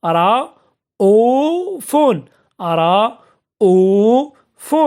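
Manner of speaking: رؤوف very slow, broken into long separate syllables. Shown as a demonstration. Teacher sounding out an example of hamza, رؤوف syllable by syllable. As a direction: stretch each syllable of رؤوف, pausing between them.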